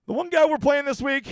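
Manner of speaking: big loud showman shout; shouted build-up. Acted. Teasing the pick reveal to viewers, loud hype shout. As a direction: yell it like a hype man.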